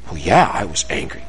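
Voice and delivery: put-on low, soft grudging kid; acted sheepish child; reluctant admission. Child reluctantly admits anger in role-play.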